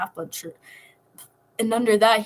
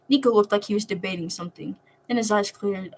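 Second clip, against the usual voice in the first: usual speaking voice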